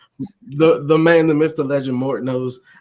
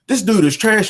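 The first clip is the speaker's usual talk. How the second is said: trash-talker; 8 semitones higher, 5 dB louder than usual. Retold or imitated. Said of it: "people be on, on the mic like".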